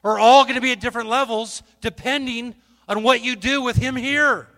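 loud, fervent preaching to the crowd; full-force preaching. Acted.

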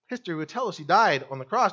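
ordinary delivery

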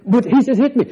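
loud, high-pitched indignant mock whine; mock protest. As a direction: protest like the wronged pupil. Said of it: "He says".

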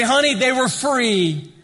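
high, loud, drawn-out punchline; comic husband. Acted. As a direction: play a husband proudly blurting it out, slowly. Acted husband's comic alternative answer.

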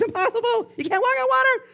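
very high, squeaky protest; hammed-up high-pitched incredulity; incredulous exclamation. Real, not acted. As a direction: squeal it in comic disbelief. Exclaiming at an impossible feat.

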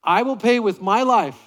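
loud, leading the congregation in a vow; loud oath. Shown as a demonstration. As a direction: say it big so the room hears. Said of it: "say it nice and loud".

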